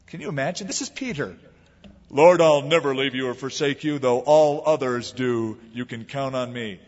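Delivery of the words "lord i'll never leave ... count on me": loud, declamatory vow, slowing midway; bold pledge. Acted. Peter pledging loyalty to his Lord, boldly. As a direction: proclaim loyalty in a big voice, easing at the end.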